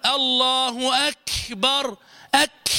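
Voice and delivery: "أكبر" with a long rasping air burst after K; overdone hams. Shown as a demonstration. Teacher showing what hams is not, overblown K. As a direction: exaggerate the ك in "أك" into a kh-like hiss. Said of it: "وليس معنى الهمس أن تقول".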